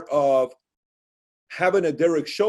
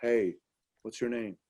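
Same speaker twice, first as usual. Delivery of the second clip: smooth pickup line; 5 semitones lower, 8 dB quieter than usual. Retold or imitated. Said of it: "He would talk to girls like this".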